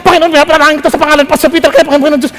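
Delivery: very fast rattled-off prayer; rushed prayer. Retold or imitated. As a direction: gabble the prayer without thinking. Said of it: "Sa bilis ng panalangin". (mabilis na dasal)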